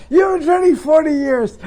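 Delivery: laughing. Real, not acted. Laughing at guest's answer.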